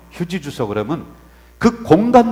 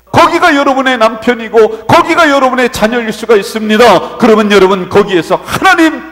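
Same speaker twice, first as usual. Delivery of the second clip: loud, raised, driving sermon voice; full-force preaching; 7 semitones higher, 11 dB louder than usual. Real, not acted. Pastor urging congregation to serve where placed, forceful preaching. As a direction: press each point on the congregation without letting up.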